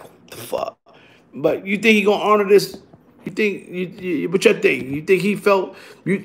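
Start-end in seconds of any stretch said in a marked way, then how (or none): 0.0-0.8 unvoiced, breathy whisper of a frightened cop; scared cop whisper. Cop backed by the crowd, whispering in fright. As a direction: mouth it like a spooked officer.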